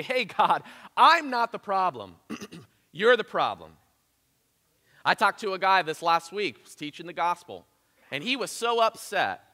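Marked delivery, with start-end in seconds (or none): 0.0-3.8 loud, high defiant voice to God; mock accusation shouted at God; defiant retort. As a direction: throw the blame back at God. Mocking defiant words to God.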